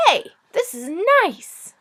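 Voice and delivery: the dragon thrilled by the glowing walls; delighted dragon. Acted. Dragon delighted by crystal cave.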